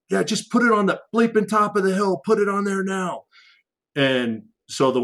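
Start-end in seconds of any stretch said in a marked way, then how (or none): 0.1-3.2 desperate order to drop the bomb; urgent demand. Retold or imitated. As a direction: yell it like a soldier under fire. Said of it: "And he goes".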